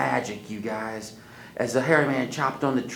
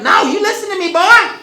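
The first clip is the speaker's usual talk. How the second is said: high, loud firm grandma; stern scolding; 18 dB louder than usual. Acted. Grandma scolding Wiley, loud stern. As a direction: lay down the law.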